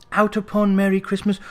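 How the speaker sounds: ordinary delivery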